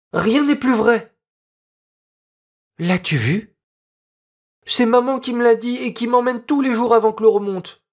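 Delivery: put-on a village boy patiently explaining the tide; earnest peasant boy. Peasant boy explaining the tide to Noémie, earnest child voice.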